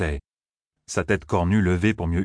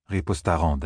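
usual speaking voice

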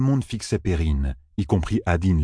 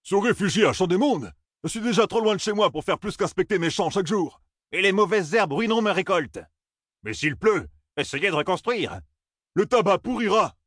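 high-pitched, outraged farmers protesting; indignant cries; 12 semitones higher than usual. Acted. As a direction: throw out objections, pitch raised high. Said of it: "leurs cris indignés".

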